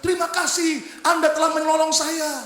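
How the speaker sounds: lightly voiced high grateful woman. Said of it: "wanita ini berkata".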